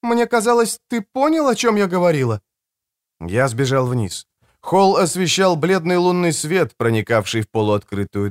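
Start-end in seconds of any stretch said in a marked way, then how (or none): 0.0-2.4 high woman's voice, protesting; defensive woman. Acted. Woman answers the raging man, high defensive. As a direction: protest back in a high female voice.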